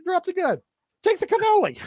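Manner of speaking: high squeaky mob voice; high mobster voice. Retold or imitated. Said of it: "Godfather quote".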